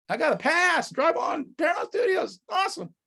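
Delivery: thrilled shout of arrival; excited. Retold or imitated. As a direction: cheer it like you just got the pass. Excited arrival at Paramount lot.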